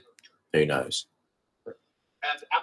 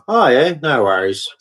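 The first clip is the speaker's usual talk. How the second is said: mock pity; 3 semitones higher than usual. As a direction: fake sympathy, mocking their whining. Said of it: "you're sitting there going".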